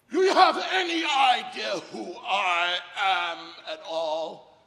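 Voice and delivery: loud, slow, high indignant shout; irate traveler. Retold or imitated. Man confronts airport worker, outraged shouting. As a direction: bellow it at airport staff, stretching words. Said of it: "a guy walked up to me and goes".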